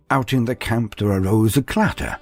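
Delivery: plain reading voice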